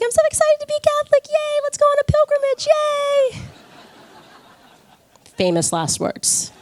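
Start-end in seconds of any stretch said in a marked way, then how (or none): 0.0-3.4 very high, fast mock squeal of excitement; giddy newbie. New convert cheering about a first pilgrimage, giddy. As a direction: gush in a squeaky, overeager little voice. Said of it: "just so young and excited".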